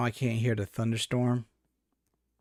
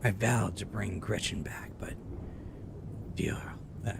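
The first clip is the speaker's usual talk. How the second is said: hushed, haunted diary whisper; whispered narrator voice; 4 semitones lower, 8 dB quieter than usual. Acted. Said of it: "my best impression of, of, of narrator voice".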